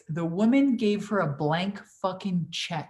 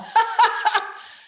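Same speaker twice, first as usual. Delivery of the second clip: high burst of real laughter; 13 semitones higher, 5 dB louder than usual. Real, not acted. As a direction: laugh out loud at the comment. Laughs at viewer comment.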